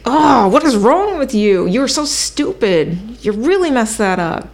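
loud, high, scornful scolding; harsh inner critic. Shown as a demonstration. Scolding yourself after a mistake at work, harsh and loud. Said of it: "Or is the voice more like".

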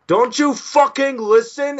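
high, drawn-out mock outburst; exasperated scolding. Hunter snapping at someone touching the mushroom, mock scolding. Acted scolding cutting off the speaker.

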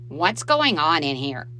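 loud, slow demand. A narrator's reading. Brother entering on the girls' fight, demanding. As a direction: burst in demanding answers.

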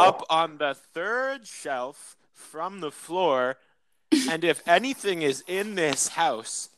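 loud slow announcement. Retold or imitated. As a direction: call it out slowly for all to hear. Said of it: "loudly announced".

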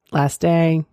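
ordinary talk, nothing marked

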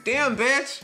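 high, loud burst of dismay; loud, appalled outburst; shocked exclamation. Real, not acted. Exclaims over gross bed.

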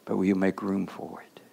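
hushed unvoiced question; whisper. Real, not acted. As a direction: lean in and whisper it to the congregation. Preacher drops to a whisper.

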